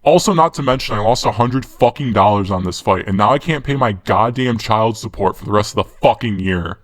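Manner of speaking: ordinary delivery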